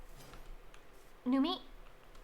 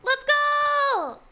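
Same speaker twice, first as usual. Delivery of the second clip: loud high shout; excited cheer; 13 semitones higher, 9 dB louder than usual. Real, not acted. Finding open area to build.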